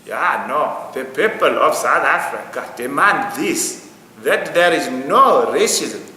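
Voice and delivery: imitated South African accent, loud and slow; heavy put-on South African accent, declaimed slowly; Idris Elba. The actor after ten years here, in a South African accent. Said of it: "let Idris Elba come out with".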